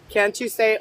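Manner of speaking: normal talking voice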